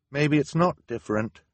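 mildly downcast, high-pitched; sad. A narrator's reading. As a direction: voice a doubt with a touch of sadness. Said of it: "Violet said sadly".

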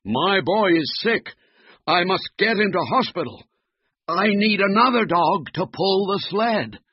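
high-pitched, hurried father's plea; urgent plea. Acted. Father needs a dog for his sick boy, urgent. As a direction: plead urgently, pitched well up. Man urgently seeks help for son.

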